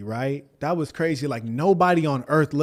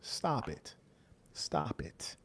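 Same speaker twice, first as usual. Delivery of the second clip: soft, much lower whispered disbelief; hushed stop it, done with him; 6 semitones lower, 17 dB quieter, much slower than usual. Real, not acted. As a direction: breathe it out, shaking your head. Laughing disbelief, softly dismissing.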